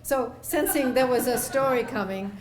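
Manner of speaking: ordinary delivery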